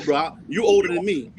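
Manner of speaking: ordinary talk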